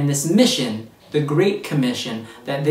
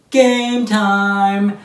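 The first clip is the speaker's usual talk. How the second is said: loud, higher, held-out announcement; game-show call-out; 4 semitones higher, 5 dB louder than usual. Pretend. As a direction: call out the start of the game with gusto.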